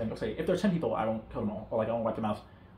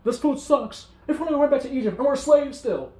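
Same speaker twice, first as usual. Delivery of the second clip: high, louder whiny grumbling; grumbling complaint; 11 semitones higher, 6 dB louder than usual. Retold or imitated. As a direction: whine like ungrateful complainers. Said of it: "Yet they're still grumbling".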